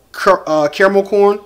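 ordinary talk, nothing marked

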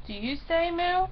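ordinary delivery

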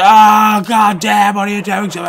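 loud, high yell as the hooked fish; hammed-up howl of protest; complaining fish. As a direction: cry out as the fish being dragged. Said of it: "He's like".

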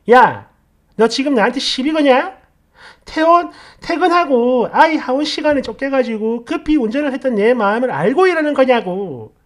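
mock angry wife, loud and high; irritated wife. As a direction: imitate her rising irritation. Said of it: "이러면서 또 짜증을 내요".

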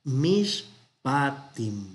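mishpatim drawn out very slowly, syllable by syllable; slow teacher's model of mishpatim; Hebrew word model. Shown as a demonstration. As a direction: spell out mishpatim slowly for students. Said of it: "Vamos a tener".

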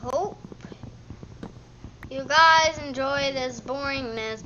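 ordinary talk

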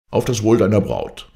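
calling out a toast. Acted. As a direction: raise your glass and call it out. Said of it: "rief er".

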